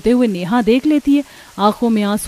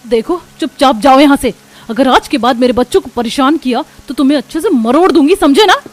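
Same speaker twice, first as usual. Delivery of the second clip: loud, stern warning; angry threat; 4 semitones higher, 7 dB louder than usual. Acted. Mother warning the bullies away, angry and threatening. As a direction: warn them off, voice rising at the end.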